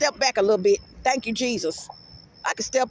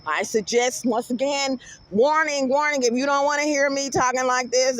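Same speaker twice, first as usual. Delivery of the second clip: ordinary talk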